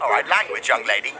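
tut-tut like a strict mom; a hint of a stern mother; mock stern parent. Scolding voice.

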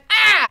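short high mock scream; a hammed-up yelp from offstage; imitated scream. Scream heard from inside the palace, high and loud mock. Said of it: "there's, like, an".

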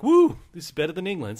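high, loud whoop; mock delighted settlers; cheering convicts. Convicts cheering their new land, high and loud. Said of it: "they're like".

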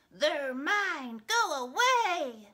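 loud, high storybook grumble; grumpy grumbling. A narrator's reading. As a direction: possessive pug snapping at his housemate. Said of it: "Pig would just grumble".

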